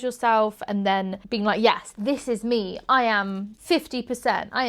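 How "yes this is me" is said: usual speaking voice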